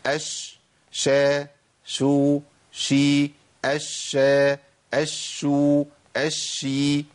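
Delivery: each syllable أش، شا، شو، شي said separately and crisply; reciting shin vowel states. Shown as a demonstration. Teacher drilling students on each shin sound. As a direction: drill the shin through all its vowels one by one. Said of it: "نقولهم بقى ورا بعض".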